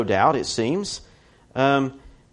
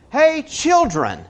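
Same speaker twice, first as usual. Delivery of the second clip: drawn-out high call across the water; mock shout of Hey, children to the boat; calling out from the bank; 13 semitones higher, 4 dB louder, much slower than usual. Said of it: "yells at them".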